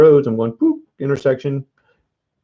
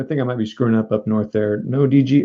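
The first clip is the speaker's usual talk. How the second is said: ordinary talk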